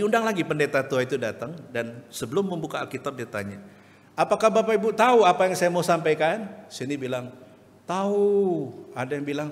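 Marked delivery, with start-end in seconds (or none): 4.2-6.5 raised, louder asking voice; questioning preacher. Retold or imitated. Pendeta bertanya lagi. 7.9-8.3 a short high, louder "tahu"; eager answer. Retold or imitated. Sebagian jemaat menjawab.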